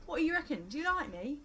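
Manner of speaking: put-on high, lilting Love Island accent; flirty contestant in a put-on Love Island accent. Host mocking Love Island contestants, put-on Love Island accent. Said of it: "doing an accent for that long".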